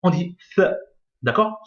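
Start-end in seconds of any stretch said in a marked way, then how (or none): 0.5-0.7 "th" as a bare consonant, blocked with no vowel; vowelless th. Shown as a demonstration. Teacher showing tha with a sukun, "th" without a vowel. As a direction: say only the start of tha, "th". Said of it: "il y a un soukoun".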